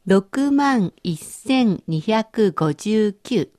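each number said very slowly; slow dictation. Shown as a demonstration. Teacher reading a number slowly for the learner to translate. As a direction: read it out slowly so they can catch it. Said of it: "私の言う日本語を中国語にしてください".

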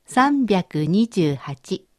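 each number said very slowly; slow dictation. Shown as a demonstration. Teacher reading a number slowly for the learner to translate. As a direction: read it out slowly so they can catch it. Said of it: "三桁の数字を言ってみますから".

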